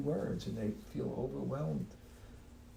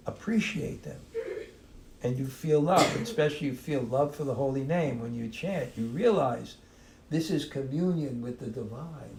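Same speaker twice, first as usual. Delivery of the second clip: ordinary talk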